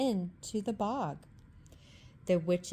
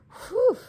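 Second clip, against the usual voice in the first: high, loud sigh of relief; relieved whew; 9 dB louder than usual. A narrator's reading. Dog relieved after the dragon flees. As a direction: blow out a big whew. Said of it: "said the dog".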